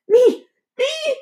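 high, loud begging "Me! Me!"; girl begging the principal to choose her, high and eager; begging child. A narrator's reading. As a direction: plead to be picked, pitched high. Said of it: "begged B B".

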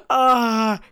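a sudden gasp then a loud, high wailing "Ah"; loud mock sob. Rose dropping her brave face, loud hammed-up sob. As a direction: burst into a hammed-up cry. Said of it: "I'm sorry, that was very loud".

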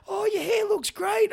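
high, slow, over-the-top compliment; mock gushing praise. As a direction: gush at the hairdo in a put-on voice. Everyone's going.

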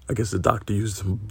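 ordinary talk, nothing marked

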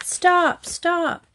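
high calls out the car window; urgent girl's shout. A narrator's reading. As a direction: yell at the passing van driver. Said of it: "lowered her window and shouted".

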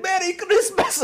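high, gleeful cry as cash flies; mock-joyous Isaac, high and loud; gleeful shout. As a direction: fling the words out like the money. Isaac's cash-throwing scene retold.

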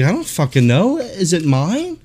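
high-pitched mock exasperation; exasperated customer. Customer answering a clueless clerk, mock exasperated.

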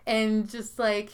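ordinary talk, nothing marked

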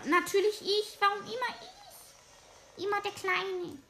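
high whining, why always me; whiny complaint. Acted. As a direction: moan like the picked-on little one.

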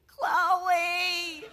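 Chloe voiced and drawn out, falling at the end; a long mournful Chloe; drawn-out sad name. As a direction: sigh the name Chloe out slowly and sadly. Mourning the guinea pig's name.

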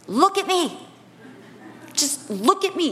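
firm, steady look at me; mother calming child. Retold or imitated. Mother to panicked daughter, firm and calm. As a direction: hold her gaze and steady her.

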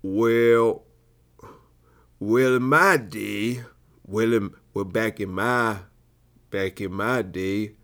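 put-on halting Southern old-timer, slowly drawn out. Shown as a demonstration. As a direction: reminisce like an aged Southern veteran.

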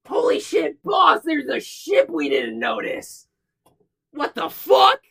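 high, loud yelping kobold screaming in alarm; a little creature shrieking in panic; squeaky kobold panic shout; panicked kobold shout. Acted. Kobold panics at unseen ship.

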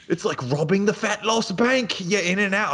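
high, put-on Australian accent, meant as British; a hammed-up Australian announcer voice; Steve's Australian accent. Podcast host imitating Steve's podcast interlude, hammed-up Australian accent. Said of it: "he's still Steve Irwin".

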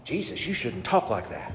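a quiet disciple's private rebuke; Peter's hushed rebuke. Acted. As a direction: pull him aside and scold him quietly. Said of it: "Peter took him aside and he rebuked him".

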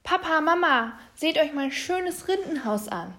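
put-on high, loud, proud child's call. A narrator's reading. Little bear showing his parents the bark house, calling out. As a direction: call your parents over to look. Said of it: "rief der kleine Bär".

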